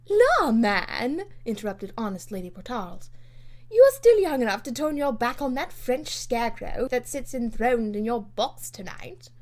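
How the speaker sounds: loud, hearty jab; bluff teasing. Acted. Lady Portarles mocks Lord Grenville, loud and bluff. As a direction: tease him boldly.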